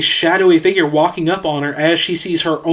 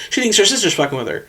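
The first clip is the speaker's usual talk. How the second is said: ordinary talk, nothing marked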